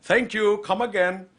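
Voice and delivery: put-on high, sing-out caricature Indian accent; mock Indian accent. Host asks guest how he'd say it, mock Indian accent. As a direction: pitch it up into cartoon Indian English.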